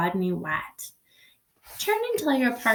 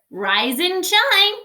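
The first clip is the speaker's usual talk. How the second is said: high, louder, slow; sing-song call to wake a sleeper; cheery wake-up call; 6 semitones higher, 4 dB louder, slower than usual. Acted. Camilla calling to leaves.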